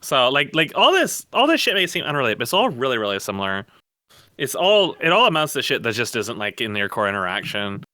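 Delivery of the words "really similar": ordinary talk, nothing marked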